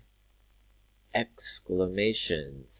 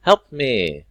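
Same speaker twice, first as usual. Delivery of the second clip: exclaiming; 3 semitones higher, 10 dB louder than usual. Shown as a demonstration. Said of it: "let's fix this".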